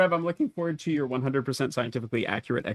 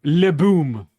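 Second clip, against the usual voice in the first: mock French; 7 dB louder than usual. Joking about French explosives.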